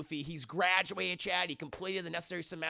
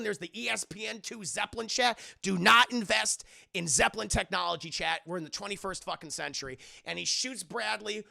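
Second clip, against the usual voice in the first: ordinary talk, nothing marked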